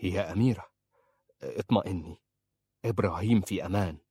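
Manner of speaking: a touch of gentle reassurance; calm her lightly and kindly. Acted. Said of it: "قال في رفق". (برفق خفيف مطمئن)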